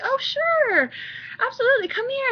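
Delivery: imitating her old eager self, sugary and high; overly eager yes. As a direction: play the overly agreeable helper. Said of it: "I was like".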